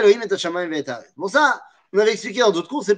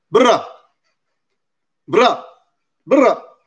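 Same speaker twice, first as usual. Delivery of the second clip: grandmother scolding; 3 semitones higher, 4 dB louder, much slower than usual. Retold or imitated. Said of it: "avec un accent à couper au couteau".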